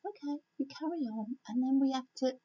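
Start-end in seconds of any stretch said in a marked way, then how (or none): 0.0-1.4 a lightly voiced, higher, softer God, easygoing; God's reply. A hint of God letting the stubborn child carry on. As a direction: hand the choice back, unbothered. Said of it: "And God just sits back and says".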